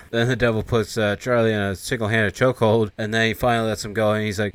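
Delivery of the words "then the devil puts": usual speaking voice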